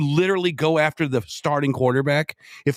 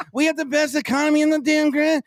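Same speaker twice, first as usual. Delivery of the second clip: whiny, high-pitched mock politician; DeSantis; 13 semitones higher than usual. Co-host mocking the candidate's economy boast, whiny imitation. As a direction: whine it way up high. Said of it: "He needs to stop being so whiny".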